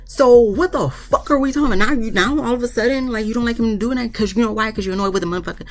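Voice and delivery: high-pitched exasperated challenge; exasperated scolding. Real, not acted. Commentator confronting a reality-show wife over her double standard, exasperated. Scolding a cast member for hypocrisy.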